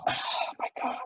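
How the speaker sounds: soft unvoiced whisper of disbelief; awed whisper. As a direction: breathe it out in awe. Awe recalled, whispered.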